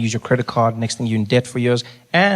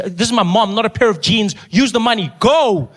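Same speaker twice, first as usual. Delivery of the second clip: building insistence; 8 semitones higher than usual. Retold or imitated. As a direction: build from firm to shouting. Ordering nurse, escalating.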